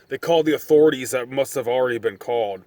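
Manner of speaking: ordinary delivery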